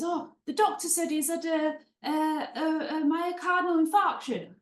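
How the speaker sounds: playing a soap hardman with an admittedly bad EastEnders accent; bad EastEnders accent for Phil Mitchell. A mock Phil explaining a diagnosis, bad EastEnders accent. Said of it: "it's such a bad accent".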